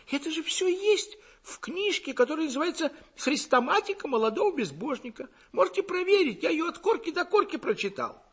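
high-pitched, flustered protest; bewildered mumble. A narrator's reading. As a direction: plead your case in a high, lost voice. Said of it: "пробормотал растерянно".

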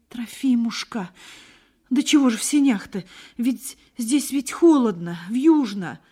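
high, anxious motherly plea; worried mother fussing over her son; pleading mother. A narrator's reading.

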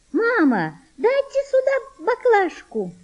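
put-on very high, drawn-out girlish call. A narrator's reading. Girl calls her mother for the flask, high calling voice. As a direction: call to mum in a high child's voice.

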